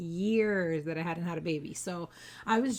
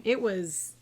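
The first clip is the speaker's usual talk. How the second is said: ordinary delivery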